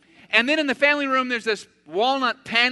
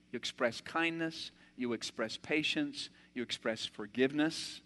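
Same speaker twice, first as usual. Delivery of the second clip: softer, lower, gentle listing; calm; 8 semitones lower, 10 dB quieter than usual. Real, not acted. Preacher calming his voice to model kindness and patience. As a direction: settle down and speak it kindly.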